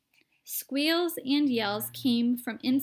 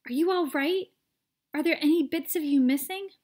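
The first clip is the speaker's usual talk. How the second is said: higher, louder worried mother; anxious cry; 5 semitones higher, 6 dB louder than usual. A narrator's reading. Mother calling to her shrunken son, alarmed. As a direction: call out in alarm. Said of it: "cried Missus T V".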